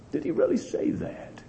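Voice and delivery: whispered sly serpent question. Acted. Serpent whispering doubt to Eve, high and hushed. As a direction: hiss the doubt into her ear.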